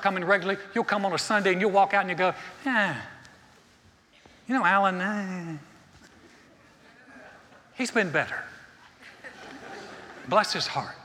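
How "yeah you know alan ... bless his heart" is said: mock-reluctant verdict, with long pauses; lukewarm reviewer. Churchgoer walking out after a Sunday sermon, mock-lukewarm and hesitant. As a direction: hem and haw through a polite put-down.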